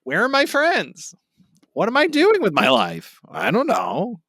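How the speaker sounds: high silly clown voice; Buggy the Clown. Pretend. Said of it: "it's me, Buggy the Clown".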